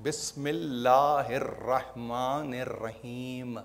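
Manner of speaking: very slow, hushed and low, each word drawn out; reverent recitation. Acted. Preacher reciting the verse to his listeners, hushed and very slow. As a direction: breathe the verse softly as a sacred line.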